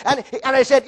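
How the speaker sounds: usual speaking voice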